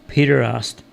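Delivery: ordinary delivery, nothing marked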